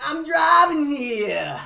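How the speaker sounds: slow, high, loud Jersey tough guy; Jersey accent. Retold or imitated. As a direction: echo the Jersey yell, hammed-up. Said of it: "Inner Jersey".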